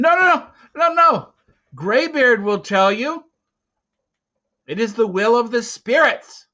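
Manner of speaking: put-on high, loud, panicked boy begging; terrified boy crying out his innocence; frantic pleading. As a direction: plead desperately, voice shooting up. Boy pleads with angry hunters.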